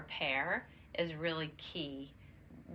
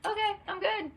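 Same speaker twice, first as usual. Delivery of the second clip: put-on much higher, louder bright kid voice; child voice; 6 dB louder than usual. Grandson saying he's fine after the apology, higher. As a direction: play the little boy, suddenly fine. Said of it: "He just was like".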